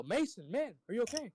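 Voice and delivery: high-pitched mock yell, no real volume; retold yell. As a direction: call out to a hurt friend, half-voiced. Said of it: "I yell".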